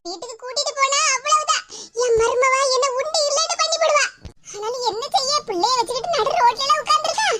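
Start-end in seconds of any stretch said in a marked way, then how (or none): none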